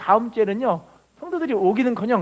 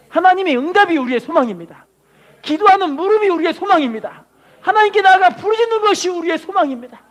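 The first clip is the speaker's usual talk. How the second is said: louder and higher, building sermon climax; preaching at full force; 8 semitones higher, 6 dB louder than usual. Acted. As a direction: build each line to a ringing cry.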